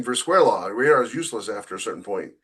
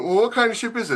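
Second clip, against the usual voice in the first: much higher, lightly voiced captain; captain asking; 10 semitones higher than usual. Captain questioning the sensor officer, lightly voiced much higher. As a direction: toss the question back as the captain. Crew skit, captain answers.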